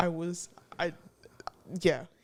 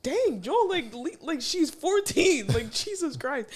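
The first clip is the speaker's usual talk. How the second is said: higher-pitched incredulous outburst with a laugh in it; retold exasperation; 8 semitones higher than usual. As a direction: exclaim in mock exasperation at someone's meanness. Her exasperated reaction, retold.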